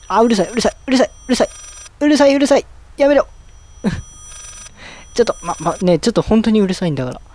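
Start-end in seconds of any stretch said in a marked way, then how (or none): 0.1-3.3 higher-pitched, repeated complaints at the noise; exasperated, pitch climbing; irritated protest. Real, not acted. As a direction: beg the painful noise to stop, rising to a yelp. Protesting painful game noise in earphones.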